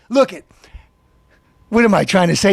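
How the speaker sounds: normal talking voice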